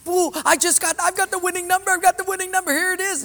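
high, eager, breathless announcing; excited claim. Retold or imitated. Winner telling the clerk he won, high and excited. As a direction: push the winning ticket forward, thrilled.

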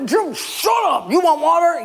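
high, exploding Moses; hammed-up rage at the crowd; acted anger. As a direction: cut them off with a high, furious outburst. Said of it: "He was doing it out of anger".

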